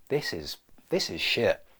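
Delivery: ordinary delivery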